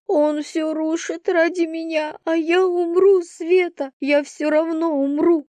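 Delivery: put-on high, distraught sobbing-girl voice; acted despair pitched well up; tearful despair. A narrator's reading. Crying girl confessing to her friend, despairing. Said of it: "начала рыдать".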